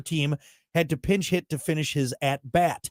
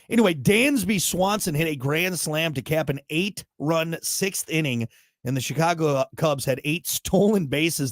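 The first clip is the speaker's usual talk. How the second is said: ordinary talk, nothing marked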